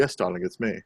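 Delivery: low, soft, far-off lady-killer purr; smug darling. Retold or imitated. To passersby sniffing coconut, a low smug purr. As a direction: purr it out like a smooth charmer. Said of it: "turn around and go".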